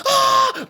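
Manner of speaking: very high mock scream; imitated yell of terror; startled scream. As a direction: shriek as if waking at the wheel. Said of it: "And Smo went like".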